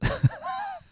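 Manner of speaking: high, sudden real laugh; burst of laughter. Real, not acted. Dad cracking up at his daughter's swearing, real laugh. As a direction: break up laughing.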